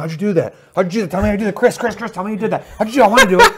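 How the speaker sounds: put-on high eager pleading kid; excited begging. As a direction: beg excitedly, giggling at Chris. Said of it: "was like".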